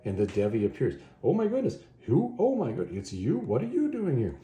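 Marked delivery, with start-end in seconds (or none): 1.2-4.3 much higher "Oh my goodness", gasping; shocked surprise. Retold or imitated. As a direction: sound startled to find him there. Devi shocked to see Conan.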